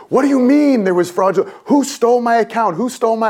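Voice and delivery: mock outrage, pitched high; extra mad. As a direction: replay the furious outburst at the bank clerk. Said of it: "I got extra mad".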